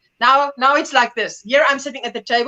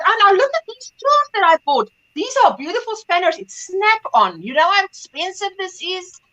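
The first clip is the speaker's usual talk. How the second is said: higher, eager showing-off voice; proud father; 7 semitones higher than usual. Retold or imitated. Father showing daughter his spanners, proudly excited. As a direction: imitate a dad marvelling at his tools. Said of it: "And then he says".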